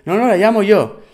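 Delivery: imitating the woman's defiant reply; mimicking the woman. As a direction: snap back in her put-on voice. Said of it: "Me dice".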